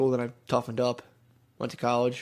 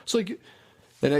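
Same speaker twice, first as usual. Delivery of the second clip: normal talking voice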